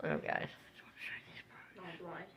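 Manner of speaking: soft whisper to viewers. Real, not acted. Vlogger whispering to viewers about yard birds, hushed. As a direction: whisper so as not to scare the birds.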